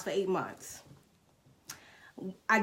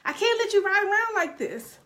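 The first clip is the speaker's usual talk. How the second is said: brother's insistence; 10 semitones higher, 4 dB louder than usual. Retold or imitated. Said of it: "he said".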